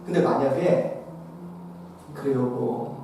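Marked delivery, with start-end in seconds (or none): 2.1-3.0 much lower and softer, gentle; empathetic reply. Retold or imitated. Wife comforting her exhausted husband, soft and gentle. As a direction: comfort him tenderly.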